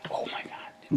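breathy shocked whisper. Real, not acted. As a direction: whisper it in disbelief.

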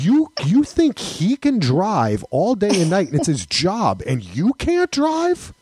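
high, mock-incredulous challenge; incredulous dad. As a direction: imitate a father's disbelieving challenge. Retells father's incredulous pep talk.

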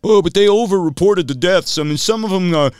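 high, loud mocking objection; hammed-up argumentative voice; mock arguer. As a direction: imitate a protesting know-it-all. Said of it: "people who will argue with you".

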